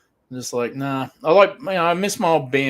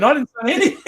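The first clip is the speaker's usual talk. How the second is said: sheepish denial; 11 semitones higher, 6 dB louder, faster than usual. Retold or imitated. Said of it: "He was like".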